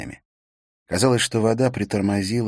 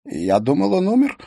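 robot voice; 7 semitones higher, 7 dB louder than usual. A narrator's reading. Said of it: "прошептал Смит металлическим голосом робота".

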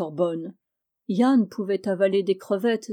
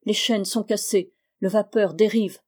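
ordinary delivery, nothing marked

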